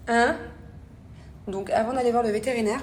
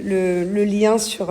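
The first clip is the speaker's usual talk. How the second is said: ordinary talk, nothing marked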